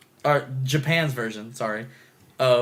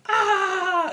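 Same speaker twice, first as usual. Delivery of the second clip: high-pitched sustained "ah"; imitated scream. Imitating girl screaming on hill.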